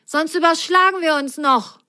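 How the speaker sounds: a small sock calling out while swinging; little sock calling. Acted. Lu calls to Ise on the swinging vine, high and anxious. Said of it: "rief Lu".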